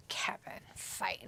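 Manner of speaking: whispered cheer; hushed ringside whisper. Real, not acted. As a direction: urge him on under your breath.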